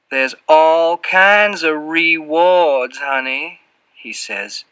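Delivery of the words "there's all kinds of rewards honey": higher, very slow Southern character voice; Southern drawl. A narrator's reading. Fat man answering the boy's offer with hints, Southern drawl. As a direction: stretch it out in a heavy Southern accent. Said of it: "drips with a heavy Southern accent".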